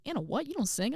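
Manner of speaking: high-pitched retold disbelief; incredulous sister. Older sister hearing about the solo, high mock incredulity. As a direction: imitate a sister's puzzled, high-pitched reaction.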